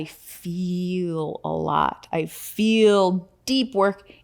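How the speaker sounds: slow emphatic. Real, not acted.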